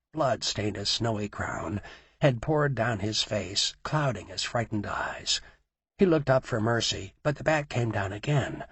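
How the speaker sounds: usual speaking voice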